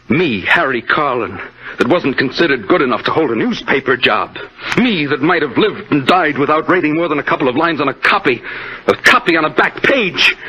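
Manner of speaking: gloating killer getting carried away; rising gloat. Acted. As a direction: let the pride swell until it bursts.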